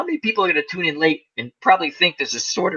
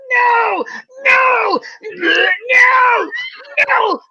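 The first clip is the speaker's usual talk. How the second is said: desperate squirrel cry; much slower than usual. Retold or imitated. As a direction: wail it like a squirrel hanging on for dear life. Squirrel voiced clinging on, spinning.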